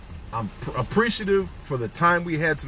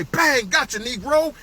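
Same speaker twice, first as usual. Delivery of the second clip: mock cop; 8 semitones higher, 3 dB louder than usual. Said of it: "the cops jump out and say".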